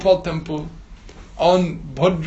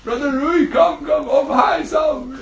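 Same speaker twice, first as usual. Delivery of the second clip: calling out; 7 semitones higher than usual. Acted. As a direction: call out cheerily to a neighbour fish. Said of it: "they're calling out".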